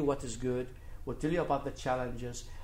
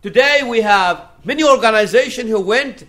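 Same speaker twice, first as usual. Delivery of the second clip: loud, high-pitched sermon delivery; impassioned preacher, voice raised; forceful preaching; 8 semitones higher, 13 dB louder than usual. Real, not acted. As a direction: preach it out with full conviction.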